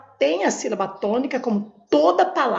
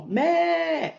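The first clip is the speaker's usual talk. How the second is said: "mé" held long and raised; lengthened tonic syllable; 5 semitones higher than usual. Shown as a demonstration. Teacher finding the tonic syllable, "mé" drawn out high. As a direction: stretch out "mé" to show the stress. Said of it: "Qual a sílaba tônica?".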